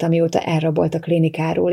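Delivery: usual speaking voice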